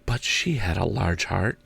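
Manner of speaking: the Ghost recalling Scrooge's sister tenderly; ghost's voice. Acted. Ghost speaking softly to Scrooge of his sister, wistful.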